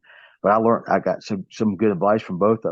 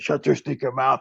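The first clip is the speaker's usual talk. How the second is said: put-on a touch higher Cuban accent, heel taunt; 5 semitones higher than usual. Wrestler taunts fans in his Cuban character, light accent. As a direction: jab it at the fans, half in character. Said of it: "you know, stuff and".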